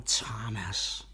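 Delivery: whispered name, low and soft. Retold or imitated. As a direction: breathe the name like a film Jesus. Advert Jesus calling Thomas.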